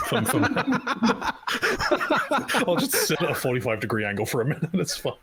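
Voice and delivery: laughing. Real, not acted. Laughing at her own joke.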